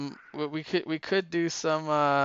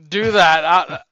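very loud mock-overwhelmed boomer; boomer impression; 3 semitones higher, 13 dB louder than usual. Co-host balking at the reading list, loud boomer impression. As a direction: protest loudly like a put-upon boomer. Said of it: "I was doing my Bomer impression".

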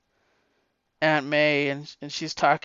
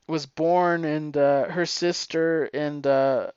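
ordinary delivery

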